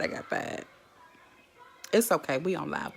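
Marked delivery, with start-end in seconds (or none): 0.0-0.6 breathless whisper, half joking; whispered confession. Real, not acted. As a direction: let it slip out under the breath. Confessing getting fired on live.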